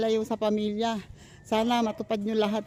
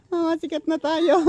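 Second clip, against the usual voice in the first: amused, raised voice full of giggles; laughing tease; 5 semitones higher, 4 dB louder than usual. Real, not acted. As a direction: call it out higher, tipping into laughter. Repeats the joke, laughing. (natatawang panunukso)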